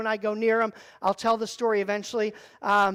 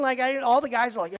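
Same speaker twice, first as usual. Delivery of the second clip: ordinary talk, nothing marked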